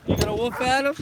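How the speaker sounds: high playful voice for the dog; pet talk. Real, not acted. Owner asks his dog Lily if she'll bark, high pet talk. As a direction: coo the question up high at the dog.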